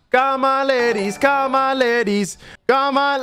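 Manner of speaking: high, drawn-out market holler; street vendor's call. Pretend. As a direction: call shoppers over like a stallholder. Calling customers into the shop.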